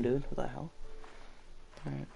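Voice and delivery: ordinary delivery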